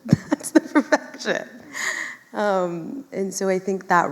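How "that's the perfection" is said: repeated through a real laugh. Real, not acted. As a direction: say it again while laughing. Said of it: "And that's the perfection".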